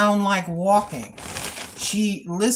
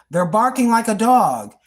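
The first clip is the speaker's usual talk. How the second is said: ordinary delivery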